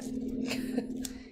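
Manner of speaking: short breathy chuckle; laugh. Real, not acted. Crafter amused at her own drama, chuckling. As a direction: chuckle at your own outburst.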